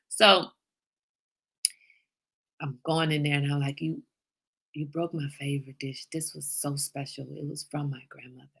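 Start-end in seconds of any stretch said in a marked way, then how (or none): 3.8-8.5 very soft, low mock hurt; guilt-trip him quietly, pretending to be devastated; hushed, put-on sorrow over a broken keepsake; fake heartbroken mom. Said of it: "He felt so bad".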